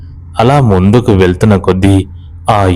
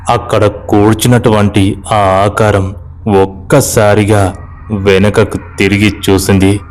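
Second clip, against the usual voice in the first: plain reading voice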